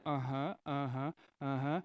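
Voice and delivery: low, distracted uh-huhs; bored agreeing. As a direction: nod along without listening. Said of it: "your friends are like".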